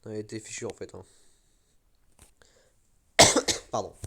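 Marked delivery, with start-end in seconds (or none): 3.2-3.7 loud dry cough; coughing fit. Real, not acted. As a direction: cough hard into the mic. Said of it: "désolé pour la toux".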